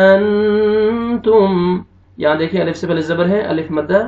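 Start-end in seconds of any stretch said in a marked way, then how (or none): 0.1-1.8 अन तुम with the न hidden and hummed through the nose, very slow; अन तुम recited very slowly with a nasal ikhfa hum; nasalized ikhfa. Shown as a demonstration. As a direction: draw out अन तुम, sending the न into the nose. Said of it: "तो आपने नोज में sound लेके जाना है".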